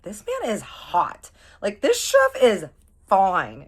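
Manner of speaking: high, loud, drawn-out gushing; smitten raving. Retold or imitated. Friend raving about how hot the chef is, smitten. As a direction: gush over a crush, leaning hard on the adjectives. Acting out drooling over the chef.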